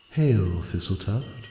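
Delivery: soft, high, otherworldly hush; ethereal voice. Acted. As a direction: speak like a spirit in the water. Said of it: "said an ethereal, crystalline voice".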